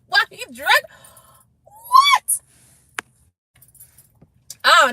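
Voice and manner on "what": very high, loud shriek of disbelief; shocked shriek. Real, not acted. Shock at drunk fight.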